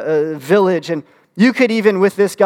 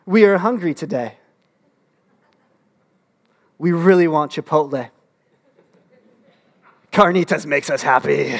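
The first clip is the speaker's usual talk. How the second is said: mock royal we. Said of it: "Saying things like".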